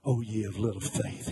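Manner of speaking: low, weary, scolding sigh of a line; condescending. Retold or imitated. Jesus scolding the frightened disciples, condescending. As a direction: sound put out and above them. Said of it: "you can hear the condescension".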